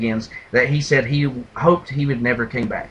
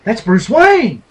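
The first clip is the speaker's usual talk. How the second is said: loud, higher mock gasp of discovery; sudden realization; 8 semitones higher, 10 dB louder, slower than usual. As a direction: exclaim it like a revelation. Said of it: "Commissioner Gordon goes".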